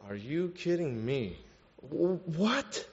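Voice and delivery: retold exasperation, stammering into disbelief; fed up. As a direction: act out exasperated disbelief under your breath. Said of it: "He was so fed up".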